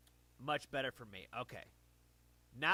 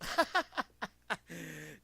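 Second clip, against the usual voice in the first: hearty laugh; 6 semitones higher, 8 dB louder than usual. Real, not acted. Laughs at own bad videos.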